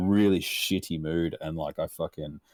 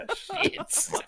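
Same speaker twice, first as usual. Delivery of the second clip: high shocked; 4 dB quieter than usual. Retold or imitated. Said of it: "I was like".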